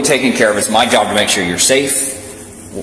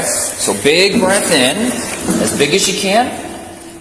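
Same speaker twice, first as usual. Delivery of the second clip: 1 slow coaching cue; 8 semitones higher, slower than usual. Real, not acted. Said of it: "coaching the breath test live".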